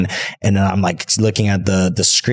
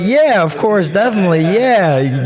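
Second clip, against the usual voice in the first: high, louder, over-eager yes to armed men; nervous eager agreeing; 12 semitones higher, 4 dB louder than usual. Retold or imitated. Tourists agreeing to a Taliban escort, high nervous yes. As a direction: agree fast and high like you have no choice. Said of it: "We're like".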